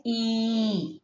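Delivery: long, held "E" vowel; prolonged E. Shown as a demonstration. Teacher showing the long vowel symbol, prolonged E. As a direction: draw "E" out steadily. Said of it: "you have to prolong the sound".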